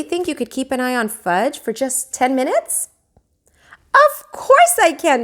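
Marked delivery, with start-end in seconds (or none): 3.9-5.2 eager. A narrator's reading. Sheila eagerly volunteers to babysit.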